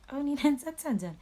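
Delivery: high, quick, loud mocking imitation; making fun. Cops teasing the two complainants, making fun. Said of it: "still part of making fun".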